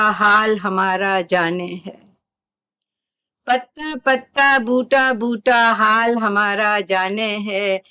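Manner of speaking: slow, drawn-out lines sinking at the ends; despairing verse recital. Shown as a demonstration. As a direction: recite the couplet with resigned despair, slowly. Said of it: "यहाँ पर एक तरह की मायूसी है".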